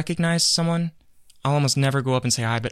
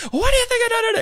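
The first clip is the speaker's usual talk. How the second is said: mock scream, very high; Bobby Lee screaming; 4 dB louder than usual. Comedian turning back to the kid, mock screaming. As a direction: snap back into a hammed-up high rant. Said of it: "screaming at this kid".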